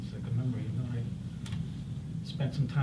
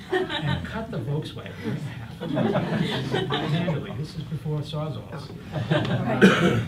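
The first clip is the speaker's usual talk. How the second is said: laughing through the words; chuckling while telling it; 3 semitones higher, 6 dB louder, much slower than usual. Real, not acted. Laughing at funny memory.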